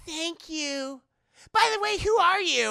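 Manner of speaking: put-on high-pitched, polite girl's voice; high, light imitation; imitated girl. Voicing the girl.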